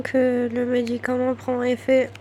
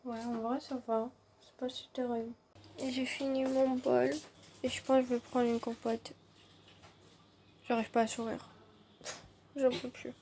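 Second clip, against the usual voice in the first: tired, swollen-mouth mumble; after wisdom teeth; 13 dB quieter than usual. Real, not acted. Exhausted, in pain after surgery.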